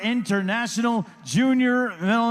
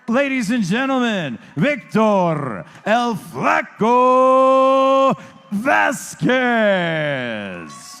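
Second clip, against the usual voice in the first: drawn-out fighter intro; 3 semitones higher, 7 dB louder, much slower than usual. Acted. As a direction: stretch the name out, booming. Announcer introduces boxer.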